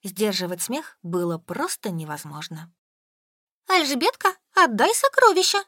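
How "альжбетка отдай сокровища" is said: high squeal, spluttering with laughter; choking with laughter. A narrator's reading. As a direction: squeak it out while choking on giggles. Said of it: "захлёбываясь, пищала Солька".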